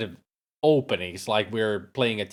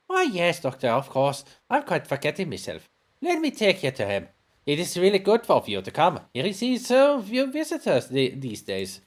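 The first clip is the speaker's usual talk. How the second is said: chatty, higher-pitched housekeeper; welcoming housekeeper; 8 semitones higher than usual. Acted. Housekeeper receiving the professor's visitors, high and obliging. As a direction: fuss politely over welcome guests.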